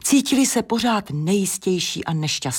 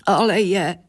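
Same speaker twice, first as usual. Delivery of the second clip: tearful insistence, acted; plaintive, mock-crying reply; sniffling; 3 dB louder than usual. A narrator's reading. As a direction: sniffle and protest. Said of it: "popotahovala beruška".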